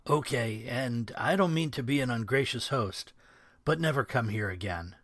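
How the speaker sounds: ordinary delivery, nothing marked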